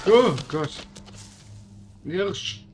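startled loud yelp; scared horror game streamer. Real, not acted. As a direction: jump at the sudden deer. Startled by sudden deer.

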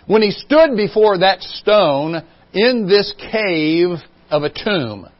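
ordinary talk, nothing marked

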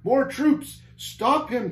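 high, urgent orders; captain's command. A narrator's reading. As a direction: cry out commands as you lose ground. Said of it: "commanded the captain".

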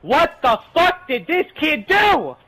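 imitating mom shrieking over the crying kid; mom yelling. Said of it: "they're like".

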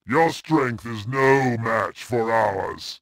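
ordinary talk, nothing marked

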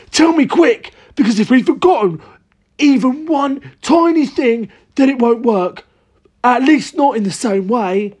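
high, agitated character voice; urgent cry. A narrator's reading. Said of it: "cried Mister Cranky".

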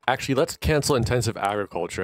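normal talking voice

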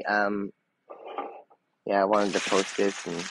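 ordinary talk, nothing marked

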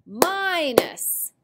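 "minus" with a high, drawn-out "mi"; stretched first syllable. Shown as a demonstration. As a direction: hold the long I in "minus". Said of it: "Notice the my comes at the first".

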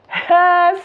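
bursting loud cackle of delight; loud laugh. Real, not acted. Reader laughing loud at her lucky cards. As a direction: let out a big delighted laugh.

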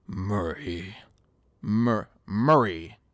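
Joker. Acted. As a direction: say it slowly with creepy control. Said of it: "that's more like it".

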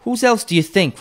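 high, loud scolding; brazen woman. A narrator's reading. First woman chiding Mrs Dilber, high and loud. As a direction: chide her for staring. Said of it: "replied the woman".

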